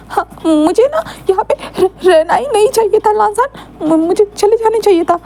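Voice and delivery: stammering sobs. Acted. Wing blaming himself to Lancer, stammering sobs. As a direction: blame yourself while crying and stammering. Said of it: "इतना ज़्यादा हकलाओ मत".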